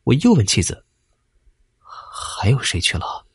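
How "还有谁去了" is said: slow, faint whispered question; weak whisper. A narrator's reading. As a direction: ask it with no strength left. Injured husband asks again weakly.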